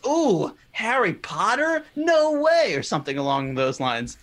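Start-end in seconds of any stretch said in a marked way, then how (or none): 0.1-2.8 high, drawn-out mock amazement; exaggerated disbelief, leaping up in pitch; LeBron. Quoting LeBron's bad movie joke to the co-hosts, mock amazement. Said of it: "when LeBron goes".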